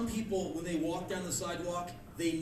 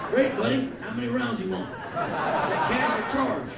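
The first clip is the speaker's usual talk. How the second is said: high, loud, chirpy store clerk; eager salesman; 7 semitones higher, 6 dB louder than usual. Acted. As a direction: brightly make the sale, loud and high. Eager gun seller acted.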